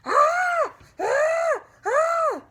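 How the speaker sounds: piercing high screams; scary scream. Acted. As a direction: scream to scare Grandma. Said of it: "I did my scariest scream".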